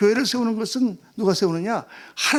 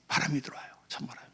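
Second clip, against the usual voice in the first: breathy hush as if a draft creeps in; soft whispered aside; 6 semitones lower, 10 dB quieter than usual. Acted. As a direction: whisper it like a chill wind. Illustrating cold wind through gap.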